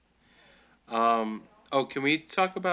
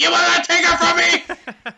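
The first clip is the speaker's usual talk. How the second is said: very high, screamed melodrama; Anakin Skywalker; 11 dB louder than usual. Acted. Defying the mentor over her, over-the-top scream. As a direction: scream it hysterically, hammed to the hilt. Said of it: "some real Anakin Skywalker vibes".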